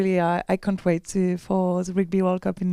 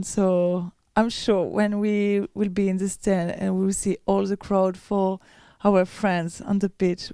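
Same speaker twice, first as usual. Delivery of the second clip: ordinary talk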